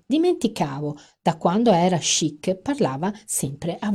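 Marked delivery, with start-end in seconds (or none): none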